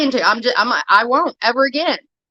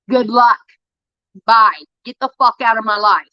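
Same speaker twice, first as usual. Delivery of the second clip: angry dismissal; 3 semitones lower than usual. Real, not acted. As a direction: slam the door with words.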